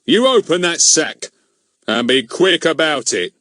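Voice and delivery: high, loud menacing order; threatening. Acted. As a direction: threaten him like a bullying constable. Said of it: "advancing on him".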